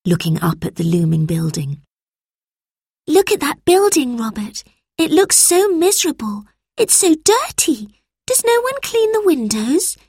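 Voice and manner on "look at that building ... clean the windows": put-on much higher girl voice, upset at the dirt; girl's voice, dismayed. A narrator's reading. Lucy complains to Robert about the filthy building. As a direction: sound disgusted, like a young girl.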